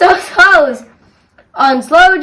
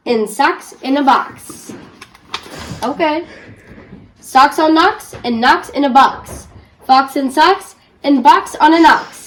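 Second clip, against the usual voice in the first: usual speaking voice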